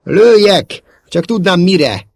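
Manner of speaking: loud, high-pitched exasperation; indignant outburst. A narrator's reading. Szóló cannot see a target to shoot, indignant. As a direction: protest hotly, voice jumping up. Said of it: "méltatlankodott Szóló".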